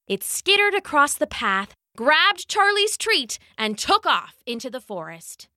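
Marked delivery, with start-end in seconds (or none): none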